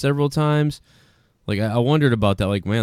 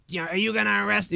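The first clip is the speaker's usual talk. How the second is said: higher, softer mock voice of a punk; Corey Feldman; 7 semitones higher, 7 dB quieter than usual. Host imitating viral yelling at the cops, mock punk impression. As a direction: lightly imitate a whiny punk challenging the cops. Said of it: "He sounded like Corey Feldman".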